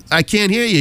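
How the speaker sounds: mock a friend who can't hear; friend's complaint. Friend picking him up can't hear him, imitated complaint. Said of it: "You're whispering to me".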